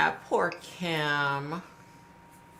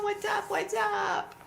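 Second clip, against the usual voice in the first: high greeting; slower than usual. Real, not acted. Greeting viewer joining live stream.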